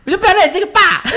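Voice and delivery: cheerful joking threat. Retold or imitated. Daughter teasing her dad about the word 变态, cheerful and joking. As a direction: tease your dad with a playful threat, laughing it off. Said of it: "用这种很愉悦的心情去带过".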